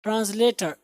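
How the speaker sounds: ordinary talk, nothing marked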